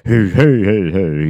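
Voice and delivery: mock [name] drawl, lightly done; a quick jokey [name] impression. Mocking the actor who kept his [name] voice, in a light [name] drawl. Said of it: "he talks like".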